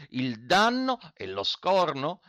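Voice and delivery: high, slow outraged question; indignant, fists clenched. A narrator's reading. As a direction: stretch each noun in protest. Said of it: "domandò lo Zirafa con le pugna serrate".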